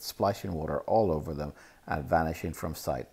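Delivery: plain reading voice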